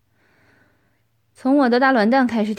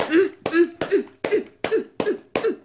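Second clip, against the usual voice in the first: rising moans; 5 semitones higher than usual. Acted. Moaning during sex scene.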